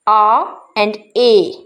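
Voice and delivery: first "a" as "ah", second "a" as "ay"; "a and a" said very slowly as "ah" and "ay"; vowel contrast demo. Shown as a demonstration. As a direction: set open "ah" apart from long "ay" in "a and a". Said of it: "this is a difference of".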